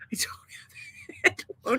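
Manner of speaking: denying it while cracking up in a whisper; laughing whisper. Real, not acted. Laughing denial of head wound joke.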